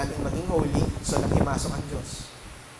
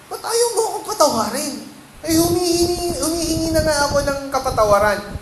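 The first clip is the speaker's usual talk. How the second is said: put-on much higher, whining like a sulky kid; whiny child pleading; 11 semitones higher, 6 dB louder than usual. Son Paul whining at his mother to be forgiven, high whiny plea. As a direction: beg like a child who feels wronged. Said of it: "Kung sasabihin ni Paul".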